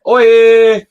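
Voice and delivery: loud, high-pitched mock holler; provocative call. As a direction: belt it out as a hammed-up call. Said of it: "somebody's gonna get mad if I'm like".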